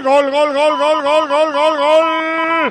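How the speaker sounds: loud repeated goal cry, drawn out; goal shout. Real, not acted. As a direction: scream the goals out loud. Goal scored, commentator calls.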